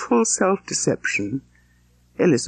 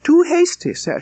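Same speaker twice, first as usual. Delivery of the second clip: high, louder protest cutting him off; cried out; 11 semitones higher, 4 dB louder than usual. Acted. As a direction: burst in to stop him mid-flow. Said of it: "she cried".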